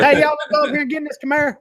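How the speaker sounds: high, fast hammed-up Southern accent; mock Southern accent. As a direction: play a hammed-up Southern good ol' boy.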